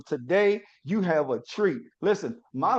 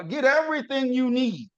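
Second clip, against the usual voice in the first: usual speaking voice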